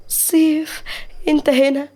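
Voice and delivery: high-pitched, glad surprise; joyful, lifted voice; happy. Acted. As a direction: greet him with delighted relief at seeing him. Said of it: "مليكة بسعادة".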